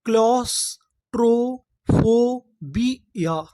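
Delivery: Claustrophobia drawn out slowly, syllable by syllable; slow syllable blend. Shown as a demonstration. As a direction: spell out claustrophobia slowly for students. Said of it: "तो देखिए हमारी पूरी meaning क्या बनी?".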